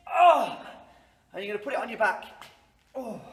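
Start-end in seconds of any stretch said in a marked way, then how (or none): 0.1-0.6 loud high effort groan; straining heave grunt. Pretend. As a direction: heave as if lifting a heavy load. Miming heavy lift.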